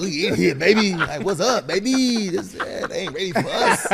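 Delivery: cocky rookie talking himself up; hyped swagger. Retold or imitated. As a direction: strut into the room in your head. Voicing his hyped-up freshman self.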